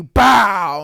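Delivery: loud mouth-made bang; mock gunshot bang; gunshot noise. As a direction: blast out a gun sound. Imitating a gunshot.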